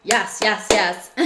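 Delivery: loud, eager burst of yeses; excited yes. Retold or imitated. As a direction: let the strong feeling burst out as a happy yes. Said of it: "when I feel things very strongly, it's almost like".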